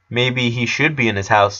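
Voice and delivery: ordinary delivery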